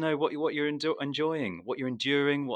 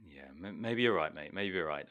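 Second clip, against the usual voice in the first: sheepish concession; 9 semitones lower, 8 dB quieter than usual. Real, not acted. Conceding quietly.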